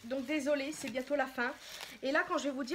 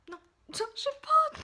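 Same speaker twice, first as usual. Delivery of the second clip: high, loud drawn-out protest; slow, high-pitched squeal of reluctance; torn wail; 14 semitones higher, 8 dB louder, much slower than usual. Real, not acted. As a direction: wail that you can't bring yourself to do it. Torn about spoiling the product.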